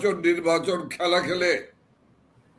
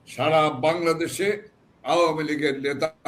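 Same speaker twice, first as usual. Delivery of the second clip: ordinary delivery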